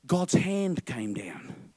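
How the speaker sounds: slow whispered line; dramatic whisper. Acted. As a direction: draw them in with a hush. Dramatic hush in story.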